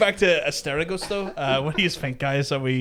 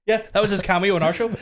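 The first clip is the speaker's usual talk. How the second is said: laughing; 6 semitones higher, faster than usual. Real, not acted. Laughing at the Ryan Reynolds clip.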